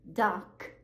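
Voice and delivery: ordinary talk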